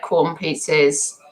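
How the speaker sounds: ordinary talk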